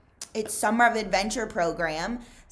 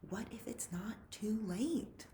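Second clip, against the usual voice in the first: soft whisper, almost breathless; whispered thought; 4 semitones higher, 10 dB quieter than usual. A narrator's reading. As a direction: whisper it to yourself, worried. Stanley's anxious inner thought.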